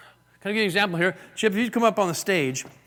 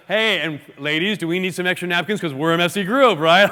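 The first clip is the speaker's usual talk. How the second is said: loud jokey call to the group, ending in laughter; openly joking; 6 dB louder than usual. Acted. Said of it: "She would have said it like that".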